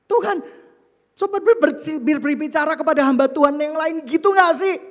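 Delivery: loud, high pleading bargain; whiny bargaining. Retold or imitated. Preacher pleading with God to ask another servant instead, loud and high. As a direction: haggle with God, plaintive.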